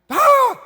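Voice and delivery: very high, very loud mock yelp; broken-English yelp. A guide turning back at tourists, garbled high yelp. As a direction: shriek out a garbled accented question. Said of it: "real broken English accent".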